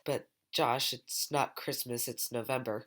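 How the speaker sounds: second voice. Acted. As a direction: skeptical friend voice. Second voice objects.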